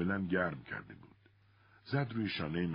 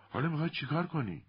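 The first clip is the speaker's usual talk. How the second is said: raised, much higher-pitched question; half shout; 10 semitones higher than usual. A narrator's reading. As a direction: call it out, almost shouting. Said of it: "دختر تقریباً داد زد". (تقریباً با داد)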